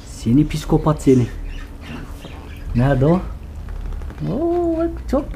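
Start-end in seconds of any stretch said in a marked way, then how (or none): 4.3-5.3 very high, soft "oo" of delight; high hushed pet-talk coo. Real, not acted. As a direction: melt over the puppy. Cooing on seeing the puppy.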